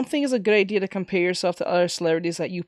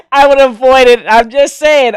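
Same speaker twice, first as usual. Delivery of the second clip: emphatic; 4 semitones higher, 10 dB louder than usual. Real, not acted. Firm warning after quiet version.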